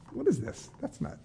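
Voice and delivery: high-pitched incredulous aside; baffled objection. Real, not acted. Pastor reacts to Reuben's offer, baffled high objection.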